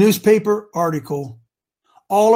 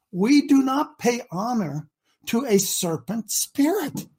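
normal talking voice

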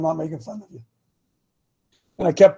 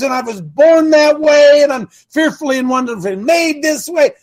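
high, forceful preaching, swelling to shouts; impassioned rant; 4 semitones higher, 4 dB louder than usual. Real, not acted. Coach getting gender views off his chest, impassioned rant. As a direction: preach it hot, punching the big phrases. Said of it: "I got that off my chest".

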